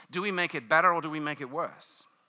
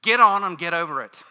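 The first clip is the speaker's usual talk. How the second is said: loud mock command; brusque talk show host; 2 semitones higher, 12 dB louder than usual. Wendy advises a woman whose relationship ended, loud and brusque. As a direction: dismiss it with a forceful push. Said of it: "Wendy's words were".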